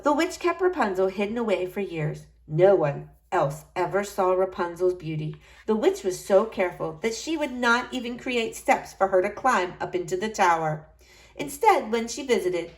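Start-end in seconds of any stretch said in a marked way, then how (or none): none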